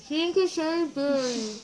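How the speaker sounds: cheeks crammed full; words lost in a stuffed mouth. Real, not acted. Five marshmallows in mouth.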